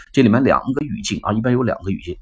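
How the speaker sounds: usual speaking voice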